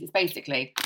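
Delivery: ordinary talk, nothing marked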